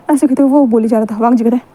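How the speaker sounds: usual speaking voice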